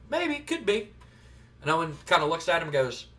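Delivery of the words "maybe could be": light, high, teasing maybe; coy. Retold or imitated. Boss dodging wrestler's guesses, coyly. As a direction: play it coy, a little higher. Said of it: "he kinda gives a coy".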